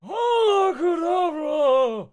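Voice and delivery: very high, very loud, slow drawn-out wizard wail; villain cry. Acted. Voldemort casting a spell at the intruders, hammed-up villain cry. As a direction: stretch the spell like a cackling villain. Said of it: "a croon's voice say".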